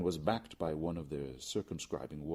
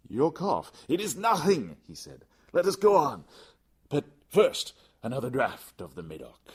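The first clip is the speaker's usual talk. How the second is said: much higher and louder, brushing it off; defiant insistence; 9 semitones higher, 12 dB louder than usual. Acted. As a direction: wave the worry away loudly. Fortunato dismisses cough.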